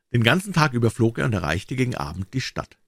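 usual speaking voice

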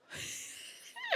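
a short breathy unvoiced laugh; airy laugh. Real, not acted. Preacher laughs remembering the house meeting. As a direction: laugh softly at yourself. Laughs at her own story.

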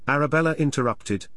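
plain reading voice

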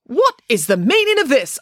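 pompous outrage at the scene; affronted mayor; indignant demand. A narrator's reading. Mayor bursting in.

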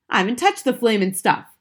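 loud, angry denial; indignant shout. A narrator's reading. Husband denying to wife he used glue, shouting. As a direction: shout it back, stung by the accusation. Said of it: "Mister Wormwood shouted".

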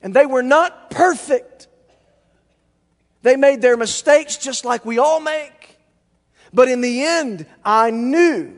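loud, high-pitched sermon delivery; impassioned preaching. Real, not acted. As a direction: preach it out with full force. Preaches at full force.

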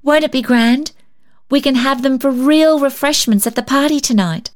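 put-on high happy child; excited child. A narrator's reading. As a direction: bubble over about the party. Polly excited about party.

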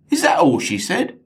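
a dim sidekick who can't believe it; incredulous accomplice. Acted. Accomplice questions the kidnapper.

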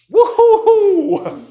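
long, high, loud laughing whoop. Real, not acted. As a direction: hoot with delight at the punchline. Preacher reacts to harsh words.

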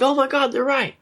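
high, delighted realization; thrilled fisherman. Retold or imitated. Fisherman after the first catch, high and thrilled. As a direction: gasp it out like a happy fisherman. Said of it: "having this great attitude".

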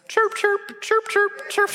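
very high chirping bird noises; bird chirps. Acted. Balaam's curse comes out as cheerful bird chirps. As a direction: chirp like a little songbird.

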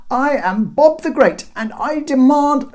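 high, loud self-important declaration; indignant bellow. A narrator's reading. Bob announcing himself to the guards, indignant shout. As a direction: demand respect from those above. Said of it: "Bob shook with indignation".

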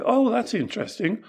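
high, soft curious reply; gently curious. Acted. Taoist master answers a student's opinion, gently curious.

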